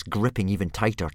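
usual speaking voice

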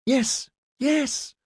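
put-on very high little-girl voice; excited children. A narrator's reading. Girls jumping around their father in happy excitement. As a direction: pipe up high like an eager child.

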